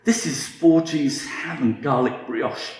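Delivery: normal talking voice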